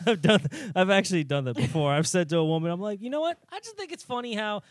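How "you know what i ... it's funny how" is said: high-pitched mock-innocent lead-in to a dig; mocking put-on voice. Retelling a jab at a woman, in a high mocking voice. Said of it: "but, like, without doing the voice".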